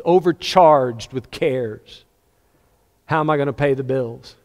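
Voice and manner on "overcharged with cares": slow quoted scripture. Shown as a demonstration. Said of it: "it says in one place".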